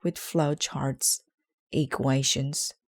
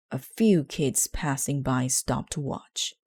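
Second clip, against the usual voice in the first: plain reading voice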